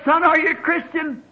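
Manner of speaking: high urgent call; retold holler. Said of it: "I hollered at her".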